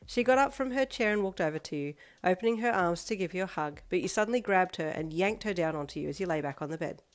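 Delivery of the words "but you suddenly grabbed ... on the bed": usual speaking voice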